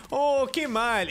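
badly imitated mock dismay; Homer. Player recites the Homer meme, drawn-out lament.

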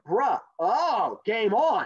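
hammed-up slang retort; mock challenge. Turtle answers the bully in slang, mock challenge.

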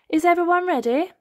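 rally the family like Mummy Pig; bright mum checking everyone. Acted. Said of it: "asks Mummy Pig".

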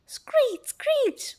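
very high-pitched squeal; possum screech. A narrator's reading. Possum running off through the house, high screech. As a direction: screech like a startled little animal. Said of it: "went the possum".

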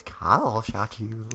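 slow, soft mimicry of a squeaky reaction; drawn-out mock high voice; mocking high-pitched imitation. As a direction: tease a friend by drawing out their squeal. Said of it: "why'd you get all high-pitched voice".

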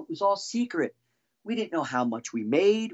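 ordinary delivery

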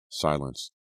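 ordinary delivery

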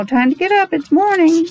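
sing-song wake-up call from the farmyard; a hint of a cheerful rooster; rooster's wake-up call. Rooster voiced waking everyone.

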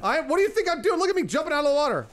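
high, loud panicked cries while fleeing a shark; panicked yelling. Real, not acted. [name] swimming from the shark, yelling at friends in panic. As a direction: yell it in real panic, voice up high.

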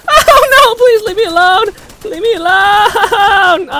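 high, loud pleading wail; panicked scream. Real, not acted. Gamer begging an attacker to leave, screaming panic. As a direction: scream for mercy, dragging out the last word.

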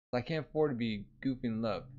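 ordinary delivery, nothing marked

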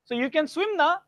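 high, loud Indian English with "na" at the end; Indian tag "na". Retold or imitated. Teacher imitates the Indian tag question, high singsong Indian English. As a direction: tack on "na" in a singsong Indian lilt. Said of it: "extra piece of information like "na".

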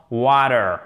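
American water with a flapped t like a d; American English. Shown as a demonstration. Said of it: "vous aurez en American English". (water avec l'accent américain, le t comme un d)

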